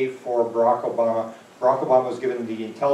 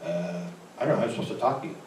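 sheepish mumble; 6 semitones lower, 7 dB quieter, faster than usual. Retold or imitated. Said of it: "I go".

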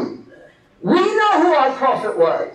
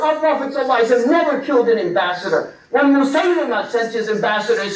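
ordinary talk, nothing marked

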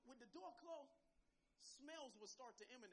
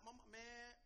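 ordinary talk, nothing marked

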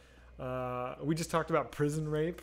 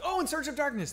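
high jump of realization on oh; suddenly getting what chat meant; sudden recognition; 12 semitones higher, 5 dB louder than usual. Real, not acted. Streamer suddenly realizing which horror doc chat meant, delighted recognition.

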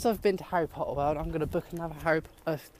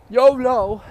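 loud, higher-pitched catchphrase yell; playful shout; 6 semitones higher, 12 dB louder than usual. Pretend. Vlogger signing off to viewers, playful shout. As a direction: holler it out for fun.

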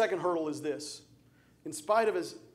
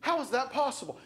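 ordinary talk, nothing marked

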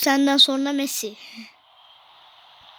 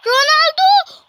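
much higher and louder cry; ecstatic shout; 11 semitones higher, 10 dB louder than usual. Real, not acted. Gamer screaming for his striker, ecstatic shout. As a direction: scream the name in joy. Cheering Ronaldo.

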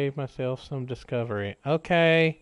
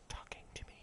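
whisper; 20 dB quieter, much faster than usual. Real, not acted. Whispered thanks.

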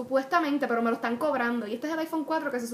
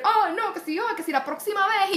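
higher, louder imitation of an exasperated father; mock annoyed dad; 7 semitones higher, 4 dB louder than usual. Imitating her father scolding her about next time, exasperated. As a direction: mimic your dad's huffy protest, pitched up. Father's reply in retold argument.

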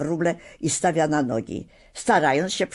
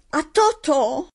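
much higher, louder anxious call; worried cry; 11 semitones higher, 3 dB louder than usual. A narrator's reading. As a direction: call out the dog's name in alarm. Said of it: "zawołała dziewczynka z niepokojem w głosie".

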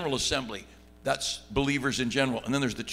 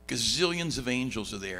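normal talking voice

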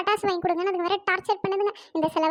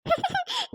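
high laugh; 10 semitones higher, 4 dB quieter than usual. Real, not acted. Laughs at neighbour's plan.

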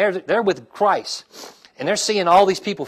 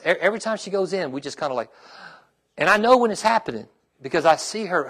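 ordinary talk

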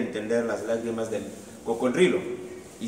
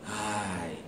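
soft sigh; 2 semitones lower, 7 dB quieter than usual. Acted. Crocodile sighs.

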